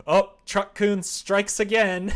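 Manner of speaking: a hammed-up YouTuber catchphrase; mock offhand quip. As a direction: toss it off like a catchphrase. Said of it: "this offhand comment of".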